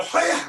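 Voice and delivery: a sharp high shout; karate cry. Shown as a demonstration. Entertainer shows kids the throwing yell, sharp shout. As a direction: yell it like a martial-arts chop. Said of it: "you're gonna go".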